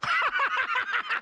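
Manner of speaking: put-on big high-pitched drunken laugh; boisterous laugh. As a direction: roar with laughter.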